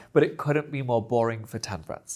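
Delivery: ordinary talk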